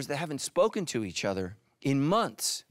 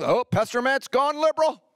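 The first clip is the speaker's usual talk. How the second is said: mock panicked churchgoer; 9 semitones higher, 6 dB louder than usual. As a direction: ham up the alarmed gossip. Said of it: "I say one word wrong".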